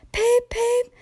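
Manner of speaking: very high, steady engine toot; whistled peep-peep. A narrator's reading. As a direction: imitate a little train whistle. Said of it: "he whistled".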